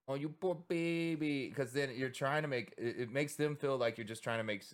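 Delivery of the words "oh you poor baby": high, slow condescending coo; mocking condescending supporters, high slow coo; mock pity. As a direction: talk down as if to a baby. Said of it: "The other thing is just like".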